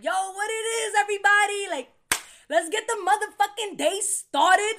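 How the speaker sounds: high, loud hype greeting; high energy. Retold or imitated. Greeting everyone upstairs with mad energy, high and loud. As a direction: burst in full of energy. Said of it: "Like, mad energy".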